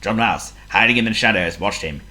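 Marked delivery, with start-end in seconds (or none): none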